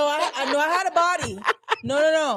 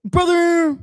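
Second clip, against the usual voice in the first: loud, higher wrestler bellow; Hulk Hogan; 4 semitones higher, 8 dB louder than usual. Acted. Co-host asked to do a wrestler, loud bellowing wrestler impression. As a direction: boom out one word like a pro wrestler. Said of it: "Okay, do Hulk Hogan".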